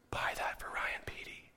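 soft voiceless whisper from beyond; God's whisper. Retold or imitated. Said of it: "and he hears".

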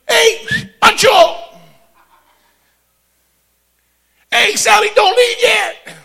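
calling out high and loud across the street; hollering after friends leaving; rooftop shout. Retold or imitated. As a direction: shout for everyone to hear. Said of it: "shout from the rooftops".

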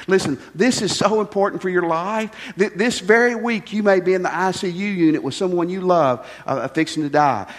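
ordinary talk

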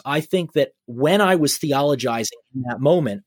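ordinary talk, nothing marked

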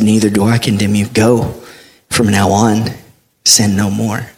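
soft, low and tender; Jesus forgiving the caught woman, soft and low; gentle Jesus. A narrator's reading. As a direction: speak it gently, like forgiving someone.

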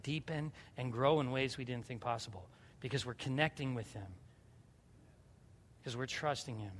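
soft, low, earnest sermon close; hushed preaching. Real, not acted. Pastor closing on trusting God in prayer, hushed preaching. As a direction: let the words settle quietly on the congregation.

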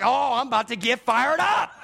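mock fired-up dad, laughing; half-hearted mock temper; laughing mock anger. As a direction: threaten with a laugh. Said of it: "I'm like".

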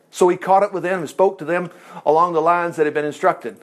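ordinary talk

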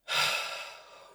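airy exhale without voice; demonstrated sigh. Shown as a demonstration. Meditation guide letting go of busy thoughts, sighing out. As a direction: let the breath go audibly. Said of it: "You can even add a sigh".

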